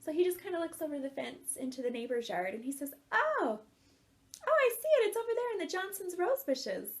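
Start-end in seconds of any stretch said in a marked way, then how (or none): 3.1-6.9 high, loud excited call; husband on the balcony calling out where the balloon landed; husband spotting it. Retold or imitated. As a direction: point it out with a burst of discovery.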